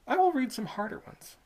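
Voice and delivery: put-on high, eager young boy; a country lad answering, pitched high; boy's voice. Boy answering the toll keeper, high and eager.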